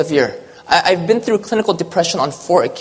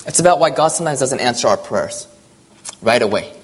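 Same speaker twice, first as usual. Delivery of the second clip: ordinary talk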